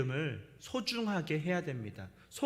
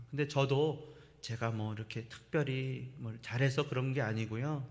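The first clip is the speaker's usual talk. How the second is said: softer, lower, modest reply; humble gentle answer; 6 semitones lower, 4 dB quieter than usual. Shown as a demonstration. A believer modelling a reply to someone asking about peace, soft and humble. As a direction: answer quietly and humbly, full of grace. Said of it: "우리가 이렇게 대답할 수 있어야 됩니다".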